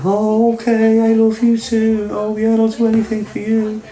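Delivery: mock sweet girlfriend. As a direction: coo it like a manipulative girlfriend.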